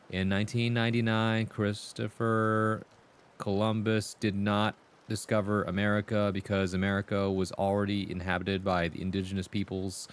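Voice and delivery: monotone recital, evenly pitched and droning; classroom reading voice. Shown as a demonstration. Student reciting from a textbook in class, droning reading voice. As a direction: read dully off the textbook. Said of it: "that one reading voice you have when you recite things in class".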